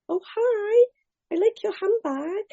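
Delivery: high, breezy friendly greeting; light, chirpy hello to a stranger; woman chirps a friendly hello to the crying newcomer; sweet stranger. Retold or imitated.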